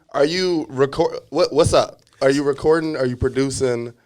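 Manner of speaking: ordinary talk, nothing marked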